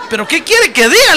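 high, loud, laughing retort; laughing protest. Retold or imitated. Husband answering his wife's elbow jab, laughing protest. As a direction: defend himself through laughter.

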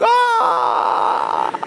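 high, long mock shout of ah; disciples' amazed cry. Disciples reacting to the mic drop, hyped holler. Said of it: "all the disciples are like".

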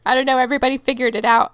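ordinary talk, nothing marked

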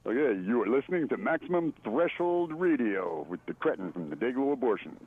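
announcer voice for the radio ID; punchy radio promo read. Shown as a demonstration. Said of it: "Can you do a promo I D for our radio show?".

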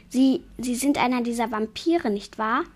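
plain reading voice

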